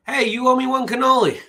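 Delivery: high, loud, hammed-up yell after a thief; angry shout. Luke shouts after the old Jedi running away, hammed-up angry shout. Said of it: "Angrily, Luke shouted after him".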